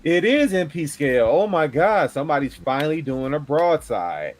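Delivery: high-pitched, thrilled disbelief; retold amazement, pitch lifted; acted-out crowd excitement. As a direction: play a fan going nuts over a reveal. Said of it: "the crowd went nuts for this thing".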